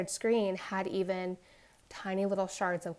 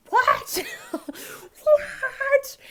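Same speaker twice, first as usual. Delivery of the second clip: high, loud shriek of disbelief; retold screeching shock, far louder and higher; shocked outburst; 10 dB louder than usual. Mother reacting to the shattered tablet, shocked shriek. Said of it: "I did have that initial reaction".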